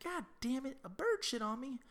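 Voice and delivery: a high, whiny imitation of the neighbour cursing the bird; high mock outrage.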